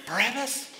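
high, rising disbelief; alarmed question. Acted. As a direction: ask it as the startled prisoner. Prisoner questions what he hears.

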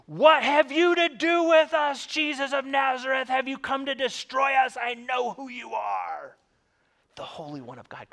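demon crying out. Acted. Possessed man in the synagogue cries out at Jesus, loud shouted demon voice. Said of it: "cries out loudly".

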